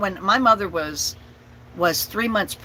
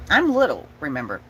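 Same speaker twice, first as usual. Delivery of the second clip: usual speaking voice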